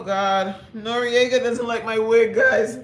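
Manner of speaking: ordinary delivery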